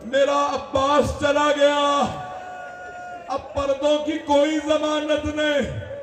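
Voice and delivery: lamenting Zainab calling out to the women; grieving cry. Acted. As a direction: cry it out as a grieving sister, voice climbing. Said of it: "जनाब ज़ैनब ने आवाज दी".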